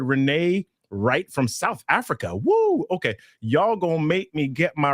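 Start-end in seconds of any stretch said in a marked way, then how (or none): 2.3-2.8 excited whoop. Real, not acted. Cheering a viewer from South Africa.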